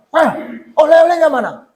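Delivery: pushy mock-child demand. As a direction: mimic a child demanding presents from Dad. Child greets father demanding souvenirs.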